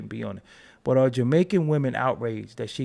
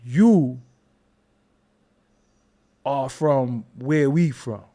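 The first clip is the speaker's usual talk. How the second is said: slow emphatic; 5 dB louder, slower than usual. Retold or imitated. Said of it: "You'll be like".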